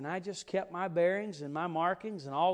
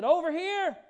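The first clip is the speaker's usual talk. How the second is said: loud, high shout across the woods; yelled call; 11 semitones higher, 10 dB louder than usual. Retold or imitated. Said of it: "he yelled".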